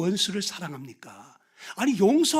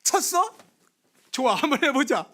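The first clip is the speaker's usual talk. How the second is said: much higher, laughing dare to fight; mock fight challenge; 4 semitones higher than usual. Preacher acting a man who was slapped, a laughing mock challenge. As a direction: square up like you are stripping off your shirt. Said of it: "나도 모르는 사이 우통 벗어가지고".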